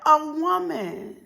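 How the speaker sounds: ordinary talk, nothing marked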